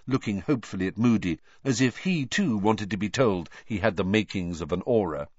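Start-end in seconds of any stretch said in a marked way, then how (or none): none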